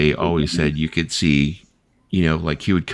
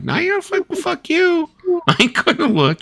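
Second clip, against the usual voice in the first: much higher, laughing, panicky no-way; scared refusal. Retold or imitated. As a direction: squeal a frightened refusal. Refusing to check scary hallway.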